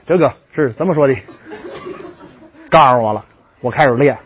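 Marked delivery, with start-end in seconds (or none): none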